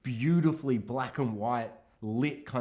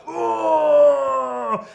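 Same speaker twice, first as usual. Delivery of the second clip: loud, high hammed-up groan; mock blinded grunt; 10 semitones higher, 10 dB louder than usual. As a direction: groan as if flashed in the eyes. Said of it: "he's like".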